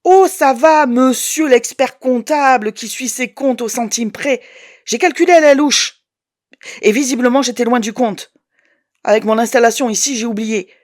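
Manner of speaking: loud, high, sarcastic outburst; mock him then snap back; mocking anger. Woman mocking her ex the accountant, loud and sarcastic. Angry phone row with ex.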